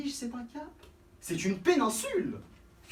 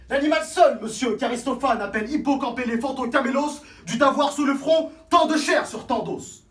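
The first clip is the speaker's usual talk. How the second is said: louder, lecturing pedant; pedantic; 4 semitones higher, 8 dB louder than usual. Acted. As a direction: show off your learning loudly. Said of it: "Pédant!".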